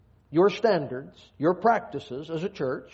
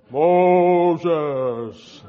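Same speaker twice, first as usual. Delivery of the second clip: a big echoing God voice calling a name, louder; booming voice of God; 8 dB louder than usual. Retold or imitated. Mocking kids' Bible stories, a booming voice of God. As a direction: boom it out like a children's audio-Bible God. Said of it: "that's the voice of God".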